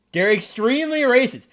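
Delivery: normal talking voice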